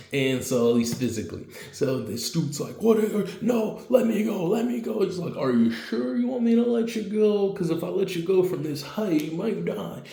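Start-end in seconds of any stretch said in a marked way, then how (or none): 2.8-5.0 high-pitched, hammed-up pleading; mock panicked plea. Retold plea to be released. 5.4-10.0 high-pitched playful taunt; mock-concerned threat. Captor taunts the dangling victim.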